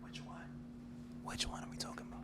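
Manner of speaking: hushed whisper, off the record. Real, not acted. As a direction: whisper it so it can be edited out. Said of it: "I'm gonna whisper it".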